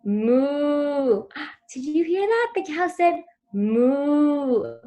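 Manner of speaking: high, loud gasp and slow, sing-song "moo"; wide-eyed wonder for a toddler; excited for a child. Shown as a demonstration. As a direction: parent showing a toy cow with a delighted gasp. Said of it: "Then present the visual too".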